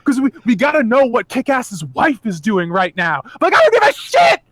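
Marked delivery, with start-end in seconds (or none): 3.4-4.4 high, loud fed-up rant; mock angry outburst. To the movie, cutting to the wife, a high loud exasperated outburst. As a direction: spit it out like a frustrated fan. Ranting about the cutaway.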